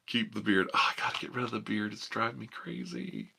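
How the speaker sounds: ordinary delivery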